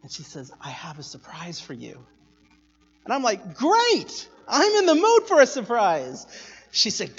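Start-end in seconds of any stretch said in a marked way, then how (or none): 0.6-2.0 low, very soft promise; hushed announcement through a smile; sweetly smiling mom. Retold or imitated. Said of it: "gave me that smile again". 3.5-6.2 high, loud burst of delight; thrilled kid. Retold or imitated. Child delighted at promised surprise.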